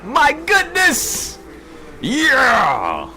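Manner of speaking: very high, drawn-out victory yells; stretched, screaming elation; euphoric celebration. Real, not acted. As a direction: holler to the room like you hit the jackpot. Celebrating a winning trade.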